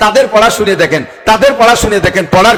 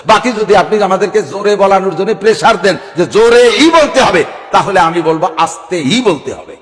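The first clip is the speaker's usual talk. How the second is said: normal talking voice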